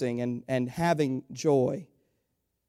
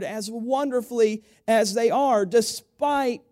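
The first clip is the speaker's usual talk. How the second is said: rising, louder preacher build; preaching; 8 semitones higher, 5 dB louder than usual. Acted. Pastor lifting the church with rising fervour.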